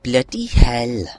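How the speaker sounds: slow, bad Welsh accent; a rubbish Welsh accent. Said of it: "very rubbish Welsh accent".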